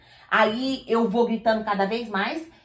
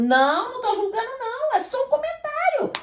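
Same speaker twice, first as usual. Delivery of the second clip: defensive denial; 12 semitones higher than usual. Retold or imitated.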